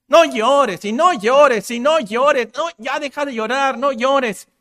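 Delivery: loud, high, repeated scolding; insistent nagging. Retold or imitated. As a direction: hammer it home like a pushy mourner. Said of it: "le decía tantas veces".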